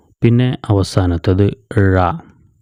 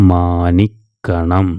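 slow joined reading; much slower than usual. Shown as a demonstration. Teacher answers her own question slowly for pupils. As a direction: draw the joined word out slowly. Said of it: "ചേർത്ത് വായിക്കുമ്പോൾ എങ്ങനെയാവും?".

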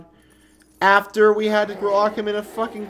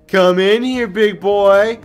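taunting lure; 9 dB louder than usual. Real, not acted. Luring the enemy in, taunting.

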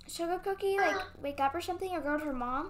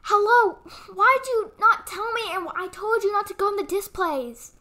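scolding on the phone; 3 semitones higher, 4 dB louder than usual. Acted. Angry phone confrontation.

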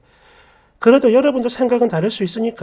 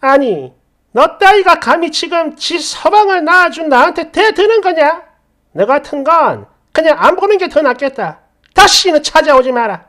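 louder, higher, contemptuous rebuke; furious mother-in-law; 5 semitones higher, 5 dB louder than usual. Acted. Mother-in-law cutting off her defiant daughter-in-law, furious. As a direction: lash out with haughty outrage. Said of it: "여기서 시모가 뭐라고 했냐면".

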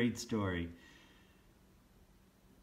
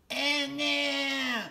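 high, loud elf wail of dismay; 5 dB louder than usual. Acted. Sad little elf wails at the door, high and loud. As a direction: cry it out like a little elf in trouble. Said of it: "a sad little elf wailed".

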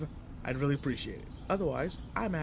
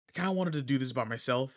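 ordinary talk, nothing marked

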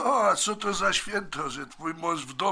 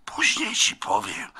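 whispered aside, hushed and quick; secret whisper; 4 semitones higher than usual. Acted. Brother whispers to his sister to keep mother unaware, hushed whisper. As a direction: whisper so mother can't hear.

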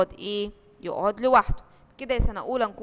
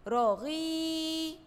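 stretched out slowly; 4 semitones higher, much slower than usual. Shown as a demonstration. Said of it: "كلمة رغيف".

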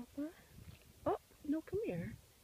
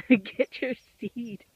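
1 laughing call; 13 dB louder, much slower than usual. Real, not acted. Laughing, loud and slow.

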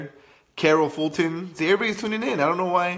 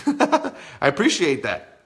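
slow words through a laugh; 8 semitones higher, 3 dB louder, slower than usual. Real, not acted. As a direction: laugh it out slowly. Laughing after joking about stalking.

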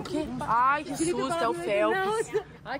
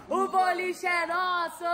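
triumphant shout; 5 semitones higher, slower than usual. Real, not acted. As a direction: yell it like a winning cheer. Repeated as a cheer. (grito de vitória)

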